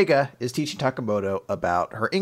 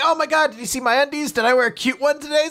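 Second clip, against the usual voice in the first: mock panic; 10 semitones higher, faster than usual. Panicking heroine voiced.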